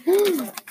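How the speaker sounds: short, high gasp; held-breath gasp. A narrator's reading. Animals hold their breath as Bear looks up, gasp. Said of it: "The animals held their breath".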